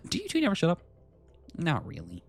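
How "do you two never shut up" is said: pitched-up exasperated complaint; high exasperated voice. Acted. Character tired of two companions arguing, high exasperated. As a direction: whine it high at the bickering pair.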